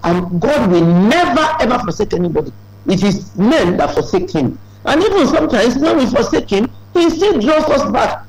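rising sermon cadence; preaching. Acted. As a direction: build the promise like a pulpit climax. Preaching rises.

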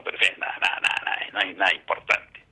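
lightly mimicked doctor brushing it off, low and hushed; dismissive doctor. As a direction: imitate the doctor waving it away, half under the breath. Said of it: "Así en ese tono".

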